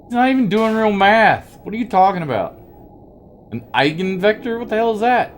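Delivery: high, loud disbelief; incredulous mockery. Real, not acted. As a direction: scoff at the fake math. Mocking game character's fake math.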